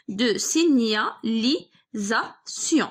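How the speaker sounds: very slow signalisation, broken into si-gna-li-sa-tion; pauses between syllables, as if writing along; syllable-by-syllable dictation. Shown as a demonstration. Dictates the word while writing it.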